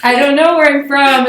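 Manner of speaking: laughing exasperation. Retold or imitated. Quoting herself, laughing.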